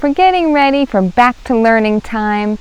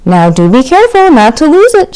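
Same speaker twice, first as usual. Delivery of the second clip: teasing; 6 semitones higher, 7 dB louder than usual. Acted. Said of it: "she teased him".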